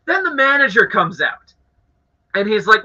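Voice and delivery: ordinary talk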